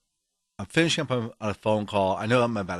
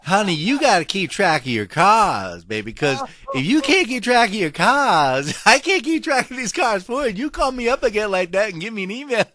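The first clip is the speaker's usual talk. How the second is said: higher, louder laughing reprimand; scolding clerk; 7 semitones higher, 6 dB louder than usual. Retold or imitated. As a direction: scold him like a fond aunt. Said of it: "She said".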